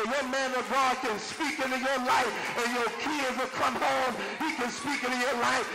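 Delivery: rhythmic, rising, climbing to a shout; hard-driving preacher build to a shouted peak; preaching at full force. Real, not acted. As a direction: whoop it up, building on every "He can speak". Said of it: "I don't know why I'm talking like this".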